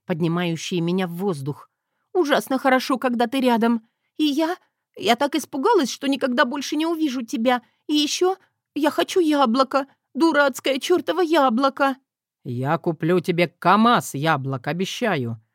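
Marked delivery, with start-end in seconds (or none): 2.1-12.0 high tearful pleading. Acted. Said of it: "прорыдала я". 12.4-15.4 low tender man's voice; husband voice. Acted.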